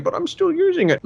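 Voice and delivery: very high, hammed-up protest; protesting organ donor. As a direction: whine it high like a panicked victim. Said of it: "while he's, like, still using it".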